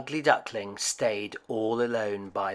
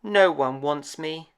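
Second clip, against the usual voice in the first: sniffing; 4 semitones higher, 3 dB louder, slower than usual. A narrator's reading. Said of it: "he sniffed".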